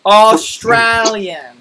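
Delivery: AUSTRALIAN drawn out slowly in an Aussie twang; mock Australian accent. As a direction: stretch it like a put-on Australian.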